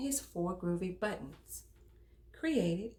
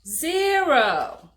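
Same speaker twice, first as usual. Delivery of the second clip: drawn-out answer; 8 semitones higher, 14 dB louder than usual. Acted. Answering the counting question.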